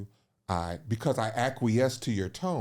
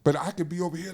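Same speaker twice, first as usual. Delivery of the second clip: normal talking voice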